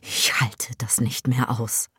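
unvoiced irritated whisper. Daughter whispers, fed up with her mother. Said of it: "Sie klingt gereizt".

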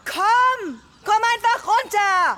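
high, loud urgent call. Acted. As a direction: shout up for her to come. Calling grandma from outside.

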